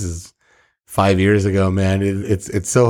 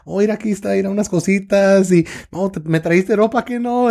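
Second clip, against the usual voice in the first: higher, chirpy mom voice in Spanish; imitating mom; 11 semitones higher than usual. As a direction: mimic an excited Mexican mom greeting relatives. Imitating his mom at airport.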